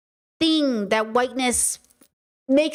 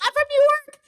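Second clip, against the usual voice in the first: very high, hammed-up New York accent; squeaky put-on New York protest; mock New York accent. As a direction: exaggerate a defensive New Yorker's accent. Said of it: "don't start actually speaking in an accent".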